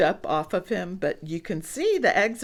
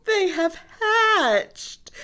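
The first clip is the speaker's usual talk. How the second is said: delighted announcement; 10 semitones higher, 4 dB louder, much slower than usual. Acted. Reader marvels at hatching.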